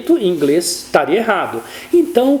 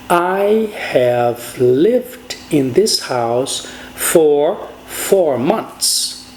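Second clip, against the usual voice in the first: very slow, each word carefully spaced; slow model English sentence; 5 semitones lower, much slower than usual. Shown as a demonstration. Teacher dictating the correct English sentence, very slow and careful. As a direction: lay out the correct English sentence slowly for learners. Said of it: "Eu devo falar".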